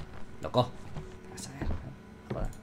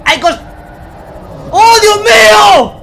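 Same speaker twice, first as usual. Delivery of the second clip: loud, slow, high frightened cry; scared shout; 11 semitones higher, 9 dB louder, slower than usual. Real, not acted. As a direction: yell in fright. Scared by monster in game.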